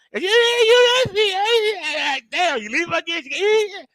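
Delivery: high, slow, strained voice; cracking under the pressure; high wavering exasperation; strained frustration. Retold or imitated. Caregiver losing it.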